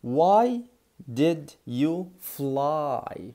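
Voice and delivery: very slow, word by word; slow teaching. Shown as a demonstration. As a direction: drag it out for learners. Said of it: "طب نيجي لدي".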